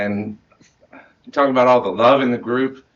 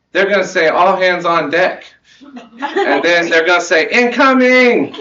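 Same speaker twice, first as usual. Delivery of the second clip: put-on higher, louder, slow toddler voice building to a squeal; excited toddler; 6 semitones higher, 6 dB louder than usual. Dad imitating his excited toddler watching the show. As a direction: play the two-year-old who can't wait for the next line. Toddler son quoted at bedtime.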